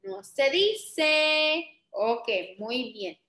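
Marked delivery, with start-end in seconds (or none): 0.0-1.8 very slow, drawn-out "No se dice"; teacher's slow chorus-answer on "No se dice"; emphatic sing-song answer. Shown as a demonstration. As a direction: stretch every syllable of "No se dice". Teacher answers own question for class.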